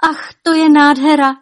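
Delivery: high, loud, thrilled exclamation; delighted cry. Acted. Wife crying out in delight at the veil. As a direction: gasp with joy at the veil. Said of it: "volala nadšeně".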